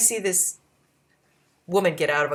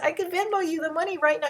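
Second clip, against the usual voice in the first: eager offer; 11 semitones higher than usual. Retold or imitated. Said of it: "I said".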